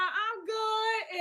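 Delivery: put-on scared little girl, high-pitched; nervous high voice. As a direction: squeak it out nervously. Retold fear facing older man.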